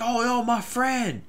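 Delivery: high, drawn-out mock cry; imitated shouting. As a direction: imitate a distraught shout without real volume. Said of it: "this guy is shouting".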